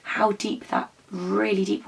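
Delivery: gasp, then a soft awed question; leaning over the well, hushed and amazed; hushed awe. Pretend. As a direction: peer into the dark and wonder softly. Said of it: "Can we all peer down?".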